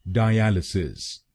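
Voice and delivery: डायलिसिस as die-AL-uh-sis, stress on AL; correct pronunciation. Shown as a demonstration. Teacher gives the right form, die-AL-uh-sis. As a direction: say डायलिसिस with the weight on the second syllable AL. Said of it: "सही pronunciation है".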